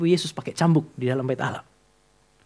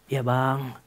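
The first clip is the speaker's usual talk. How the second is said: soft, lower, meek answer; meek polite reply; 6 semitones lower, 8 dB quieter than usual. Retold or imitated. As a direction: answer the senior timidly. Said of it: "Sopan sekali". (jawaban sopan yang pelan dan lemah)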